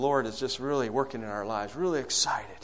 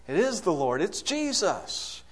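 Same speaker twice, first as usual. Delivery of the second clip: higher, drawn-out "it's Jesus"; Peter's recognition; 6 semitones higher than usual. Acted. Peter spotting Jesus on the sea, mock amazed recognition. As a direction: let it rise and stretch on the name.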